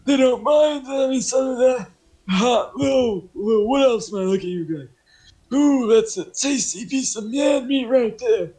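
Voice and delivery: put-on breezy, loud and high-pitched old oddball; John the oddball. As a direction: play him high and shamelessly loud. Game master plays John.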